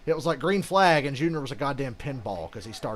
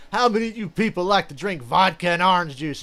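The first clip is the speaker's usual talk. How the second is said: loud, higher rock-star crowd call; 7 semitones higher, 12 dB louder than usual. Retold or imitated. Rock frontman shouting to a live crowd. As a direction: work the arena crowd like a frontman. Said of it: "[name] has a line on Kiss Alive where he's like".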